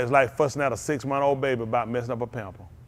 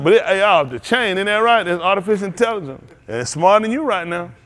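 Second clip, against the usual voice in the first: playful boast; 7 semitones higher, 6 dB louder than usual. Retold or imitated. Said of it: "I said".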